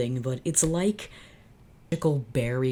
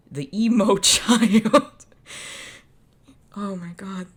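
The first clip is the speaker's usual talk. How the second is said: high, loud, slow words through laughter; laughing; 7 semitones higher, 8 dB louder, slower than usual. Real, not acted. Host bursting out laughing at the emo character. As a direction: crack up while saying it. Cracking up at the fic.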